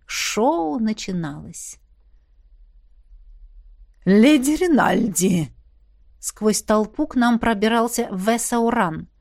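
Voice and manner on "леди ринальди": slow, drawn-out poisonous sweetness; sweetly venomous. A narrator's reading. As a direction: greet with sugary malice. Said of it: "Голос фейри сочился сладким ядом". (протяжно, со сладким ядом)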